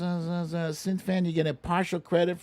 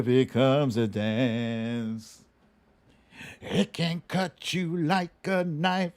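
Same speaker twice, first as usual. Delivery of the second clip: low, rhythmic spoken-word read of rap lyrics; lightly dramatic reading of a rap verse as a guessing-game clue; dramatic lyric recitation; 6 semitones lower than usual. Acted.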